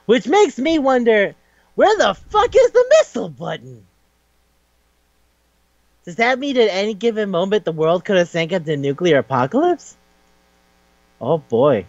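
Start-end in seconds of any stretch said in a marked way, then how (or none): 0.1-7.7 much higher outraged aside; incredulous. Real, not acted. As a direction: squeak it up in disbelief. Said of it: "aside to audience".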